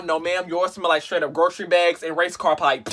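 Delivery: normal talking voice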